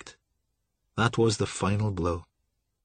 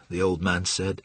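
plain reading voice

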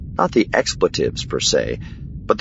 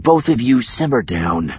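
raised-pitch, mildly stern order; stern interruption; 6 semitones higher than usual. A narrator's reading. Wedge stops the two bickering, mildly stern. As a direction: cut in firmly. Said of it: "Wedge interrupted sternly".

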